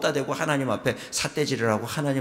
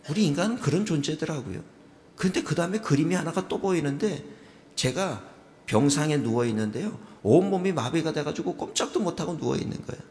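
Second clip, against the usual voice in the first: usual speaking voice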